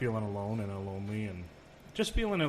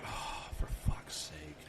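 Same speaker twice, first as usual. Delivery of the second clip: whispered mock exasperation under the breath; exasperated whisper; 9 semitones lower, 9 dB quieter, slower than usual. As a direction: breathe it out, fed up, barely voiced. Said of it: "And I'm like".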